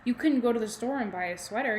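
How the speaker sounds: ordinary delivery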